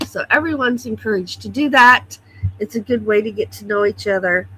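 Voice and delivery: ordinary delivery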